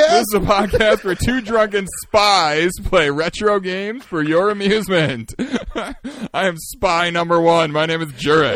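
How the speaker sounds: loud, slow, giggly announcer; grand tipsy introduction; laughing show intro. Real, not acted. Drunken spies show intro.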